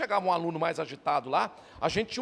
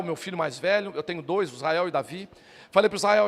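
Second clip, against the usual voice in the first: usual speaking voice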